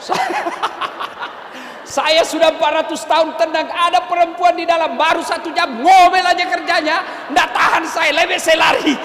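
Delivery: a fed-up spirit who can't stand the nagging; complaining jinn. Acted.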